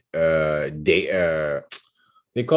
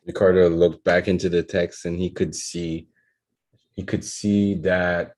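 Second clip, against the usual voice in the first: fluent, fast read-through of a draft; quick read-back. Real, not acted. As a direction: read it back briskly in one go. Reads the written sentence back.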